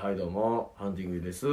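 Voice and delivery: low, soft, deflated stage greeting; a comedian's hello with no energy at all; limp greeting. Shown as a demonstration. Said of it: "どうしたの？". (元気のない低い挨拶)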